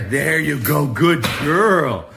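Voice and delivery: delighted praise. Real, not acted. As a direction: gush over a clever dog. Praising dog for fetching leash.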